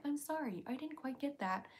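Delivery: light imitation of a smart speaker apologizing; polite robotic assistant, mildly imitated; Alexa voice. Mocking how a triggered Alexa answers, lightly imitating. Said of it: "Alexa to respond and be like".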